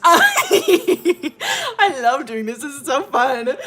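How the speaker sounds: loud, high squeal through real laughter; laughing delight. Real, not acted. Storyteller loving the game, loud delighted laughing. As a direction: burst out gleefully, cracking up.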